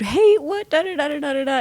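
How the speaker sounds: imitating an indignant man, hammed up; mock indignant man. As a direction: squeak out an outraged protest. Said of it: "And he said".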